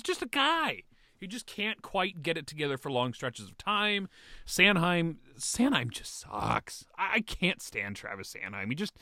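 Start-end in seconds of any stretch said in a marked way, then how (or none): none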